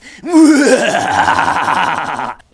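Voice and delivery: loud, high, drawn-out cackle; hammed-up monstrous laughter; evil villain laugh. Pig's head evil laugh, acted.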